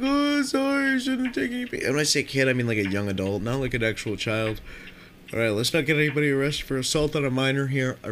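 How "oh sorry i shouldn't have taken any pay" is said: much higher, whiny mock voice; mock whiny apology. Beaten young guy whimpering an apology in a much higher mock voice. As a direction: squeak out a pathetic sorry.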